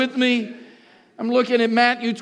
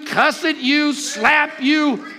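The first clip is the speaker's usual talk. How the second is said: usual speaking voice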